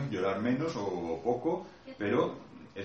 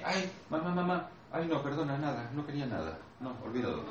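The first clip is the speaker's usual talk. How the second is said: put-on higher, offhand little child brushing mum off; child shrugging off mum's return, higher and dismissive; indifferent child; 4 semitones higher, 4 dB quieter than usual. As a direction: play a child who doesn't care. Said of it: "cuando su madre viene".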